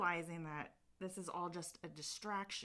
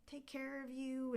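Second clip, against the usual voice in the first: fairy voice; 8 semitones higher than usual. Retold or imitated. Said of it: "they're like".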